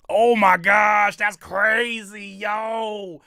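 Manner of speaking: slow, high, awestruck gasp; mock amazement, drawn out; amazed. Future fan amazed.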